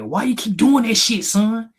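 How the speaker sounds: a touch of an angry father shaking his son; retold angry dad. Dad shaking his son, mock angry demand. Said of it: "shook me and said".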